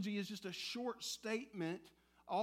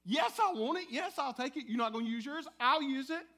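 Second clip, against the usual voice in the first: louder, higher, greedy excitement; mock the congregation snatching free money; eager grabbing; 4 semitones higher, 7 dB louder than usual. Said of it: "We go".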